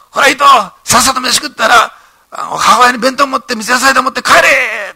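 high, loud scolding bark at Ito; boss shouting. Retold or imitated. Company president shouting at young Ito at the banquet, loud and high.

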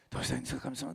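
teacher quietly asking God for help; hushed prayer. Retold or imitated. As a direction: pray under your breath. Teacher prays silently.

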